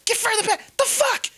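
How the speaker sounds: put-on high, fast panicky kid voice; scared kid snapping. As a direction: spit it out fast and high like a frightened boy. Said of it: "And he's like".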